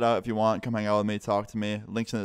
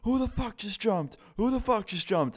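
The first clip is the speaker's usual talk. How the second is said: high, mock yell of "Who the fuck just jumped?", much higher pitch; mom yelling; 12 semitones higher than usual. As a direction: imitate the mom shrieking down from the window, voice jumped way up. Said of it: "I hear his mom yelling".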